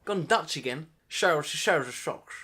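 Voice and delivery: lightly Scottish Sean Connery lisp, "Shells" with sh; a hint of Sean Connery's slushy s; Sean Connery slur. Laughing at own slip, a touch of Sean Connery. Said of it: "Oh, Sean Connery".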